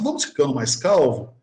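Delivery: normal talking voice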